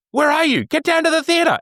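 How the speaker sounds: very high, urgent phone call; mock panic. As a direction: pretend he is late, frantic. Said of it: "calling up on a night off and saying".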